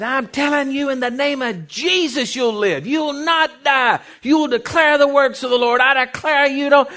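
full-force, high-pitched prayer shouting; reenacted all-out shouted prayer; screamed declaration. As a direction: command life with thunderous preacher's authority. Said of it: "I just screamed as loud as I could".

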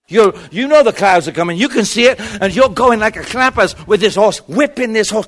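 loud, high, excited storytelling; rousing preacher's shout; full-force preaching. Acted. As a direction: build the chase at full volume. Preaches the chariot scene at full force.

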